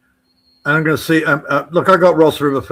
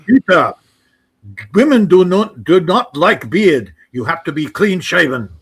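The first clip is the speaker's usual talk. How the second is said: mock German accent; 3 semitones higher, 5 dB louder than usual. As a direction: lecture like a stern German father. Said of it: "gotta do the accent".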